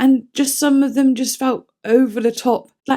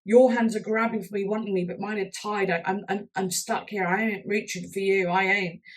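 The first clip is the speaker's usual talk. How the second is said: quiet; 10 dB quieter than usual. Retold or imitated. Explaining lyric from fan's view.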